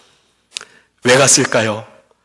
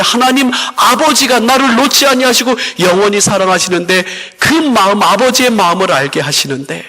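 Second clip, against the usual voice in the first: raised, ringing pulpit voice, pressing the point home; preaching; 6 semitones higher than usual. Acted. Pastor to congregation about the loving Father, preaching with fervor.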